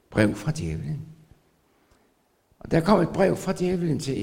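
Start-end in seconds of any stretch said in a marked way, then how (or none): 0.1-1.0 amused. Shown as a demonstration.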